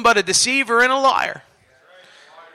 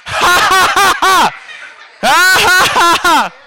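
forced hearty laugh; 6 semitones higher, slower than usual. Shown as a demonstration. Preacher showing online viewers how to laugh, forced and high. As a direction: laugh in big pumping bursts. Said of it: "start laughing at that situation".

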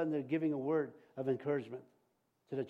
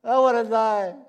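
loud, mock despairing wail to the Lord; preacher mocking a discouraged believer's loud wail; mock despairing cry; 4 semitones higher, 8 dB louder, slower than usual. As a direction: ham up a self-pitying plea to die. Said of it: "How many people have been there and said".

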